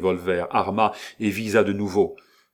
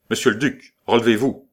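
louder, higher, commanding shout; more imperious; 4 semitones higher, 7 dB louder, faster than usual. Acted. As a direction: order him up with full authority. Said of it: "d'un accent plus impérieux".

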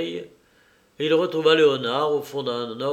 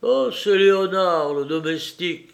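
raised, higher-pitched exclamation; startled cry easing at the end; 5 semitones higher, 6 dB louder than usual. A narrator's reading. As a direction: call out in alarm on recognising someone. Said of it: "cria Gilbert".

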